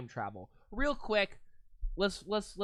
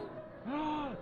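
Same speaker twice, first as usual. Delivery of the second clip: surprised gasp; 8 semitones higher, 6 dB quieter than usual. Real, not acted. Sudden real person on the ride.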